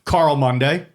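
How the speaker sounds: ordinary delivery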